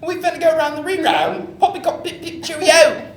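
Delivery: mock British accent. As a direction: ham up posh British slang. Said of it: "Incoherent British".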